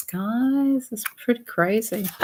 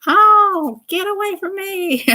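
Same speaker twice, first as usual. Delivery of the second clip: much higher, squealing playfully; mock squeal; 13 semitones higher, 4 dB louder than usual. Voicing the surfer's friend, mock shrieking. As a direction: squeal like a scared critter. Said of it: "I'm just kidding".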